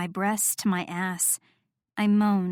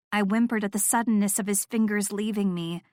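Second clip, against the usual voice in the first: ordinary delivery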